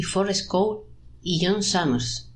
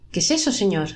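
plain reading voice

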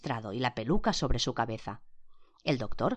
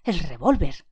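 loud alarmed shout; 2 semitones higher, 7 dB louder than usual. A narrator's reading. Man realizing the gun was used, loud alarmed shout. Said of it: "gritó Blor".

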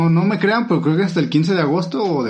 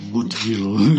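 "Good girl" dropped much lower in a put-on thick voice; mock deep voice; 8 semitones lower than usual. Mocking a TikTok guy with a fake deep voice, lower. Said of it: "pero con su voz gruesa".

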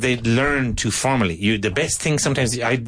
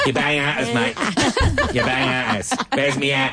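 Cockney; 3 semitones higher than usual. Retold or imitated. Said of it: "the kids were pure London".

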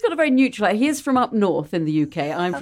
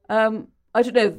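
normal talking voice

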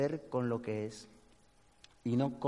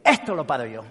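stubborn boast; 8 dB louder than usual. Retold or imitated. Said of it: "se mete en el río y dice".

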